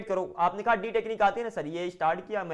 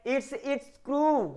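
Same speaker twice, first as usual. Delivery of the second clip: "it's, it's screw" very slow and higher; slow model answer; 6 semitones higher, much slower than usual. Shown as a demonstration. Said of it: "यह इसका screw है". (बहुत धीरे और ऊँचे "it's, it's screw")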